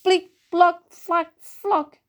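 high, separated little plops; splashing sound effects. A narrator's reading. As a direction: imitate batter splatting, drop by drop. Narrator acts out splashing batter. (bruitage de clapotis, voix aiguë)

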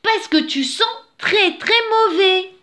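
very high, loud she-bear; exclaimed complaint. A narrator's reading. She-bear tells the dirty bear he stinks, exclaiming. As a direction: cry it out, hammering the point. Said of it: "s'écria la jolie ours toute propre".

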